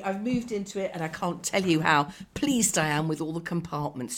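ordinary talk, nothing marked

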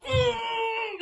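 high, strained "mm"; straining effort noise. Retold or imitated. Imitating a woman shoving at tube gates, straining. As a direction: imitate pushing hard against something stuck.

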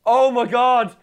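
high, loud shocked cry; shocked exclamation. Real, not acted. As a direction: squeal it out in shock.

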